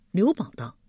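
usual speaking voice

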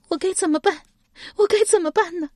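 high-pitched tearful wail; weeping. A narrator's reading. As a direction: wail helplessly in a crying woman's voice. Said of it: "嘤嘤哭泣起来". (嘤嘤哭泣，高声无助)

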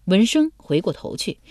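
plain reading voice

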